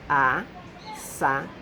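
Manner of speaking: slow, split into syllables: as-sa-do. Shown as a demonstration. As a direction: say Assado slowly, pausing between each syllable. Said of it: "Três partes". (dividindo Assado em sílabas, bem devagar)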